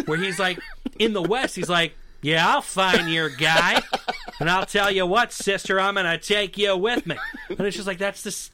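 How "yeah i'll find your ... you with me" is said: hammed-up cowboy star, leaning on each phrase; John Wayne. Podcaster showing it's the same guy out West, John Wayne impression. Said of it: "But then you go to True Grit".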